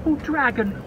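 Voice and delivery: begging creatures. Acted. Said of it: "the birds begged".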